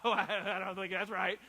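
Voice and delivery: loud, laughing reply, unsure and teasing; laughing doubtful friend. Retold or imitated. A friend laughing off his plan, loud and doubtful. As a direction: laugh it off like a friend who disagrees. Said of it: "I'm like".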